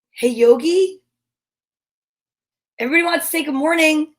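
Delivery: ordinary talk, nothing marked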